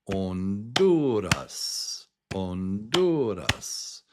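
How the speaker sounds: Honduras slowly as on-DOO-ras; Spanish pronunciation. Shown as a demonstration. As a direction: silent h and tapped r in Honduras. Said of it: "In Spanish".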